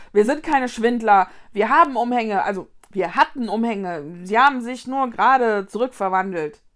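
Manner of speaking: loud, indignant protest; annoyed. A narrator's reading. Vicky denies being a swindler, annoyed. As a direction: insist loudly, offended at the accusation. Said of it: "Vicky stampfte verärgert auf".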